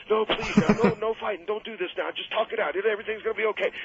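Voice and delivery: high, pleading cry of a frightened man; pleading scream. Retold or imitated. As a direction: beg them to stop, pitched high and desperate. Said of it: "Tommy hated violence".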